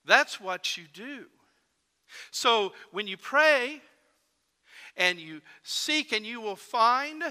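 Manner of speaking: full-voiced sermon appeal; preaching. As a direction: urge the congregation with building fervor.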